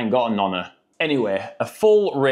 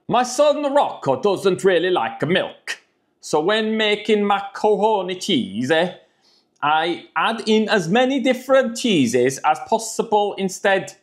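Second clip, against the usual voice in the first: reading the blurb in a higher Italian accent; 6 semitones higher than usual. Shown as a demonstration. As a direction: put on a hammed-up Italian accent. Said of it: "I feel like I've gotta do the accent".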